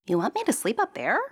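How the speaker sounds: a hint of a young princess, doubtful. Princess voice in story.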